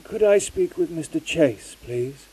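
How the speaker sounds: distant phone voice. Acted. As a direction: sound like a faint long-distance call. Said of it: "It sounded distant, unclear".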